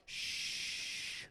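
whispered shh; shushing. Retold or imitated. Moses quieting the grumbling people, a whispered shh. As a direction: hush the grumbling crowd. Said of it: "Moses is like".

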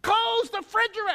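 high mock grumpy order; cranky grandfather. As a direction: boss your wife about the fridge. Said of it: "always cranky with my grandmother".

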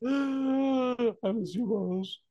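very high, drawn-out fake sobbing whine; mock crying. Grieving customer crying over Rose, mock crying. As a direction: stretch it into a hammed-up wail. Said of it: "you cry".

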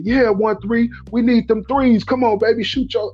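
high-pitched courtside encouragement, re-enacted; imitated cheering holler. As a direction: call out like a coach urging on a shooter. Retells hollering encouragement at the player.

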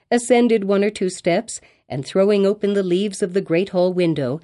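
ordinary delivery, nothing marked

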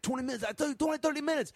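high-pitched mock insistence; retold arguing. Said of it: "he's arguing with his friend".

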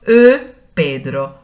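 slow Hungarian teaching voice. Shown as a demonstration. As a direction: model "Ő Pedro" clearly for students. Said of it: "Si quiero decir".

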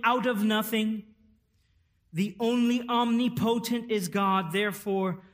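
loud, high, deliberate preaching; preaching at full force. Acted. Pastor proclaiming the Trinity to the congregation at full force. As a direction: thunder out each creator line.

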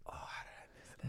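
whispered regret; barely voiced, kicking yourself under your breath; hushed dismay. Real, not acted. Regretting a missed seminar nearby.

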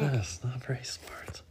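unvoiced aside; wry whispered comment. Real, not acted. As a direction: whisper it sideways. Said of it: "whispered aside".

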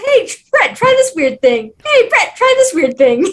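high, loud, hammed-up eager nagging; mock eager pestering. Pestering a co-host to try odd films, mock eager.

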